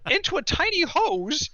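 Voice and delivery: strangled high wheeze, imitating breathlessness; half-laughing choked voice; mock choking gasp. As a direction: squeeze it out like through a tiny hose. Acting out choking in hospital.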